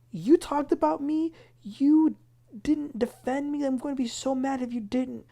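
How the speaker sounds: accusing Lisa. Retold or imitated. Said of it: "she's like".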